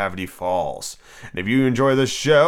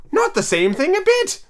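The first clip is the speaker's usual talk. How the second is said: Hatter; slower than usual. Acted. Hatter corrects the line.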